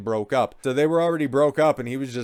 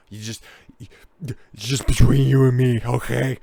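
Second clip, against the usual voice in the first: put-on drunk voice; 5 dB louder than usual. Playing drunk confiding to cop.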